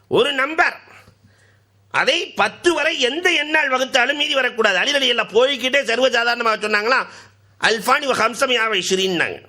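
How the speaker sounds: high, ringing sermon voice; fiery preaching. Acted. Preacher exhorting the beloved listeners, high and ringing. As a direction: preach it out to the crowd.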